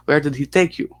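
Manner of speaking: lightly acted Russian interpreter accent. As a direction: ask it in a put-on Russian accent. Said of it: "you sound like a Russian person".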